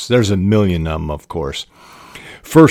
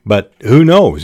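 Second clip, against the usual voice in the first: ordinary delivery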